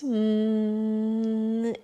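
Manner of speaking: steady bee-like hum; humming. Shown as a demonstration. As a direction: hum with lips closed. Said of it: "While humming".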